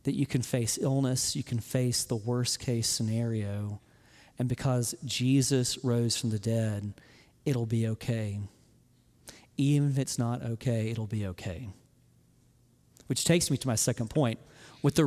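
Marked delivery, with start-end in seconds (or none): 0.0-11.7 low, hushed comforting tone; quiet reassurance. Real, not acted. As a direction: softly reassure them, voice dropped low. Preacher repeats the comfort to listeners.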